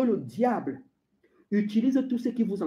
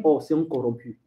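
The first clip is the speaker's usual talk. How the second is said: low, sinking pronouncement; God's disappointed verdict; 8 semitones lower than usual. Acted. God judging the believer who gave up, low-voiced. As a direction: drop deep for the divine judgement. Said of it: "Ton Dieu va dire".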